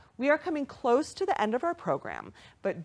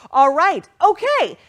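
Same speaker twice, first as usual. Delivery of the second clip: bursting out high and loud; thrilled by the thermometer; excited; 8 semitones higher, 11 dB louder, slower than usual. Real, not acted. Host reacting to fundraising total, excited shout.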